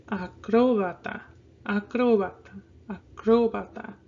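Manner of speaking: "Acrobata" with cro stressed firmly; whole word "Acrobata", leaning hard on the second syllable. Shown as a demonstration. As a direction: punch CRO in a-CRO-ba-ta. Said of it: "I put more of a stress".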